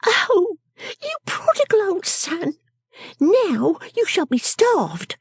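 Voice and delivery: high, slow, drawn-out reproach; scolding Miss Wren. Acted. Miss Wren threatening the prodigal, high drawn-out scolding. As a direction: scold him slowly in a high little voice.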